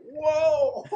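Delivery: high, loud, laughing whoa; a shocked laughing gasp; startled whoa. Retold or imitated. [name] recalls seeing the photo, laughing loud high whoa. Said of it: "And I was like".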